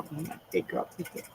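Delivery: quiet self pep talk, almost under the breath; fading self pep talk. Real, not acted. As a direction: repeat it to yourself, dying away. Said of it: "suck it up and be a big girl".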